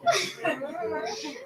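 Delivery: short high-pitched laugh; real little high laugh. Real, not acted. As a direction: laugh briefly, high and softly. Laughs after wanting to be rich.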